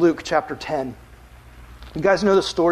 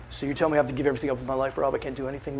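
quick whiny objection; 4 semitones lower, 6 dB quieter, much faster than usual. Retold or imitated. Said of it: "imagined listener objecting".

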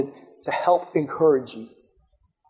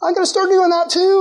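delighted surprise; 9 semitones higher than usual. Retold or imitated. Preacher voicing church members who suddenly feel loved, high and amazed. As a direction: gasp it like you never knew you were loved. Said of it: "all these people around you are like".

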